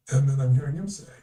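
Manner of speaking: normal talking voice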